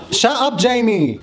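high playful shout of shut up; mock telling-off. Real, not acted. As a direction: snap at him jokingly. Telling off the recording.

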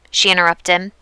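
ordinary delivery, nothing marked

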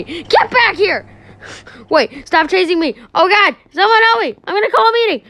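high, loud cries while being chased; panicked yelling. Acted. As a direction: shout for help in panic. Chase, calling for help.

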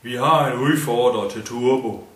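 loud call to the camp; shouting. A narrator's reading. The drunk man shouts to his camp about a challenger. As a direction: yell it across the camp. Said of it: "Tormanden råbte".

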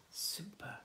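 gasp, then a hushed super; gasped whisper. Real, not acted. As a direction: breathe it out in wonder.

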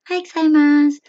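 very high-pitched, loud imitation of a Japanese woman; tiny high Japanese woman's voice. Shown as a demonstration. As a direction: make the voice small and high, like a Japanese woman's greeting. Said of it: "Parang ganun".

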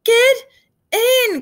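very loud, high shout; shouted order. A narrator's reading. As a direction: bellow the command across the water. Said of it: "Carter heard Dexter shout".

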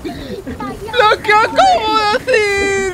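slow, high, loud disbelieving cry; ecstatic yell. Real, not acted. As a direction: shout it out in triumph. Celebrating own big play.

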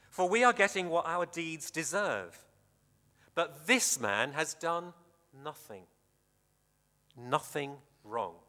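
usual speaking voice

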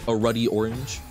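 ordinary delivery

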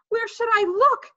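put-on high, loud girlish question; flustered. A narrator's reading. Sheila is told to find the teeth, flustered. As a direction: ask in a helpless fluster.

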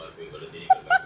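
breathy high-pitched giggle; surprised high laugh at the parrot; high squeaky giggle. Real, not acted.

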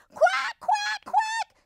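very high, loud quacking; enraged duck. A narrator's reading. Mother duck flying into a rage at the wolf, furious quacking. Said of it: "flew into a rage".

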